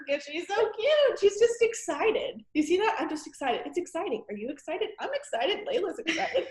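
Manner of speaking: high, cooing delight; excited gushing. Real, not acted. Host gushing over a cute teammate, excited gushing.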